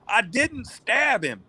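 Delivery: high, drawn-out mock scream without much volume; mildly hammed-up high-pitched protest; retold screaming. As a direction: imitate a man wailing his innocence, slowly. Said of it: "just screaming this out loud".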